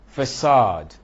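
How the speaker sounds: "facade" punched loud on CADE; weak first syllable in "facade", strong second; stress on second syllable. Shown as a demonstration. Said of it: "does not take stress".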